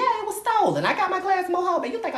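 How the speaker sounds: high showy declaration; high dramatic claim. Acted.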